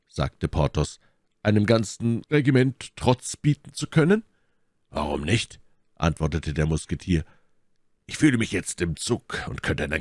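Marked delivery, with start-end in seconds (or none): 1.4-4.2 high-pitched questioning disbelief; incredulous. A narrator's reading. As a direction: ask it as if it were madness. Porthos incredulous at Athos's boldness.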